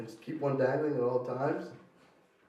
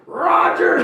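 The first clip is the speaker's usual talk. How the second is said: much higher, louder mock cheer; mock eager shout; 12 dB louder than usual. Dad mishearing the name, loud high shout.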